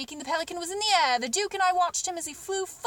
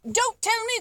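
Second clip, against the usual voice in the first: higher and louder, cutting in; eager cry; 7 semitones higher, 3 dB louder than usual. Acted. Duke interrupting the giraffe, eager and raised. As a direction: jump in before she can finish. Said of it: "cried the Duke".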